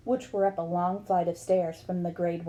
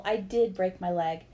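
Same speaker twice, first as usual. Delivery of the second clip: ordinary delivery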